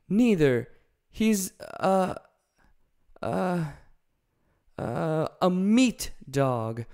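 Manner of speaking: hesitate, then slow; Dorothy fumbling to explain Toto; Dorothy's voice. Acted.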